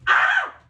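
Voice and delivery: very high, loud shriek; piercing yelp of excitement; excited scream. Real, not acted. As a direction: let out a freaked-out scream. Freaking out over the cards.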